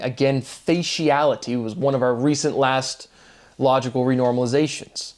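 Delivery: ordinary talk, nothing marked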